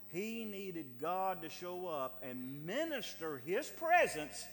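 slow build to a high loud peak on presence; preaching build. Real, not acted. Preacher driving home the need for God, slow then loud.

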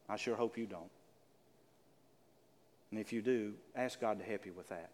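low, soft, heartfelt pleading; earnest appeal. Real, not acted. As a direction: speak softly from the heart before prayer. Earnest closing appeal before prayer.

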